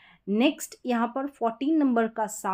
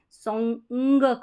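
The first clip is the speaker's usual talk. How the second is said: Song said slowly, ending in a clear ng; slow model word; 5 dB louder, much slower than usual. Shown as a demonstration. Teacher modelling the ng sound for students, slowly. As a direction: stretch song out so the final ng rings. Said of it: "यही वाला एन और जी का जो उच्चारण".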